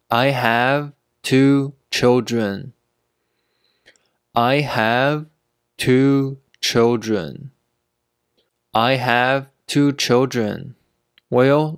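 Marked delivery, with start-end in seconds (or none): 4.3-7.5 slow, with a pause mid-sentence; slow teaching pace. Shown as a demonstration. Teacher modelling "two children" for learners, slowly.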